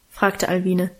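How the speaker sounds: usual speaking voice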